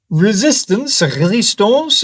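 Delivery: lightly put-on German accent on resistance. Mocking the strange German word, light German accent. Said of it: "as they say in the chosen country".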